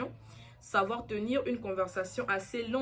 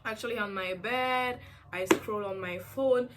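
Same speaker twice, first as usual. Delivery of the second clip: slow, laid-back English answer in a lightly put-on voice; easygoing example answer, slowed down; casual English reply; slower than usual. Shown as a demonstration. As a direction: drawl out a relaxed chatty reply. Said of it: "tu peux répondre genre".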